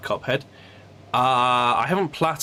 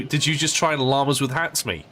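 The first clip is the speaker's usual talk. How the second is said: ordinary delivery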